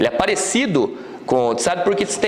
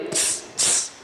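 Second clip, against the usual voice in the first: quiet tss, tss, tss hisses; teacher imitating stones clicking; hissing tss like stones; 7 dB quieter than usual. Shown as a demonstration. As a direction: spark noise of two stones striking. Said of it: "Duas pedrinhas batendo ali".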